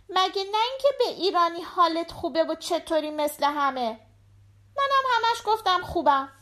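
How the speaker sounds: put-on high-pitched little girl chatting to her dad; little daughter. A narrator's reading. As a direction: voice a young daughter, pitched well up. Voices the daughter. (صدای زیر دختربچه)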